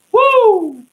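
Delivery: very high, loud woo; loud whoop. Real, not acted. Freestyler breaking off between lines, loud high whoop. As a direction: let out a piercing whoop.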